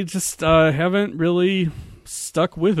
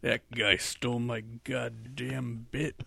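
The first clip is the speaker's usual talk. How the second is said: slow, much softer, low put-on grumble; 3 semitones lower, 7 dB quieter, slower than usual. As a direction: mutter it slowly like a grumpy old star.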